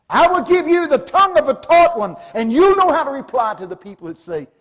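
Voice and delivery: high, loud scripture proclamation; loud rousing preaching; preaching at full force. Acted. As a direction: proclaim it to the whole room.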